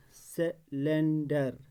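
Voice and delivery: Cylinder slowly in beats, se, len, dar; syllable by syllable. Shown as a demonstration. Teacher showing the tongue moves three times, syllable by syllable. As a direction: stretch cylinder out with breaks between syllables. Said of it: "ज़बान तीन बार हिलती है".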